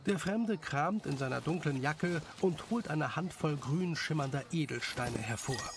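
plain reading voice

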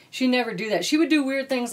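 ordinary talk, nothing marked

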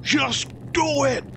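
hammed-up just do it pep shout; Shia LaBeouf. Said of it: "In the eternal word, self of".

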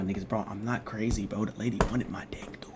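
soft secret whisper. Real, not acted. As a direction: lean in and whisper it. Confiding aside to viewers.

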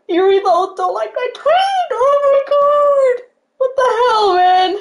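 high, loud, stretched squeal on the name; giddy squeal. Real, not acted. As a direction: squeal it again, still in shock. Fan repeating idol liked tweet.

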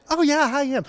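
perky. Retold or imitated. Susie Wee answering the fan on the bus, perky. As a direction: chirp it up like an eager exec. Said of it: "And she's all perky".

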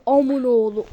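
usual speaking voice

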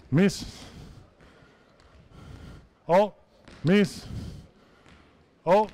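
normal talking voice

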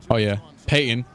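ordinary talk, nothing marked

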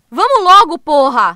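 loud, furious urging; angry shout. Acted. Clara yelling at her partner to hurry, furious. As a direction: snap at him to move now.

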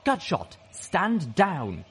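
sharp order. A narrator's reading. Skydive orders an armed comrade to stand down, commanding shout. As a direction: call out a firm order. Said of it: "Skydive ordered".

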